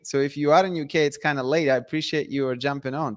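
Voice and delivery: usual speaking voice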